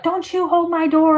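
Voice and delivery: mock-furious demand not to hold the door; imitated scream.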